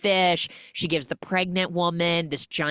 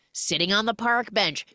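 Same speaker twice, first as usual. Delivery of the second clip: ordinary talk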